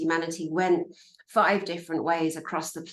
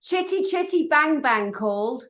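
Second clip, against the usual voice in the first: mock jeer; 8 semitones higher than usual. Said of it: "So I said the other day".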